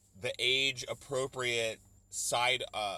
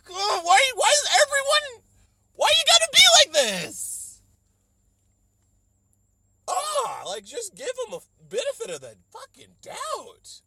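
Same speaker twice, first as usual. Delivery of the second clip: shrill high comic plea, much louder; exasperated wail; 15 dB louder than usual. Acted. Begging the admissions office to give him a chance, in a loud high squeal.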